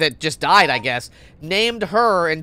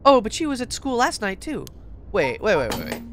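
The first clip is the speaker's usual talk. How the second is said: ordinary delivery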